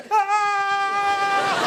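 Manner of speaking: high panicked shriek; scared shriek. Retold or imitated. Sister running through the dark, high scared shriek. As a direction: shriek like something grabbed you.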